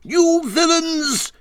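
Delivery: high, slow, loud villains; furious shout. Acted. Rat shouting at the motorists, furious and high. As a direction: hurl it at the vanished car. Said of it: "he shouted".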